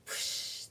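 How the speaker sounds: short airy whoosh; whoosh of spraying water. Shown as a demonstration. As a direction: imitate a burst of sprayed water. Imitates spraying trunk water.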